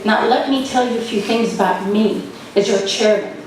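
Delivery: ordinary talk, nothing marked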